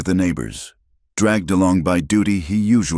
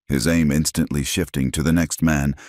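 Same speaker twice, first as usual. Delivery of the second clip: plain reading voice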